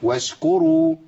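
mock the too-long ش; واشكروا with the ش stretched; overlong shin. Shown as a demonstration. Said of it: "الرخاوة مش طويلة قوي كده".

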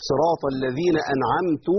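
أنعمت with damma on the ت, slow recitation; deliberate error أنعمتُ said slowly; wrong vowel demo. Shown as a demonstration. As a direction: recite أنعمتُ as the mistaken first person. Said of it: "أي أنعمت أنا".

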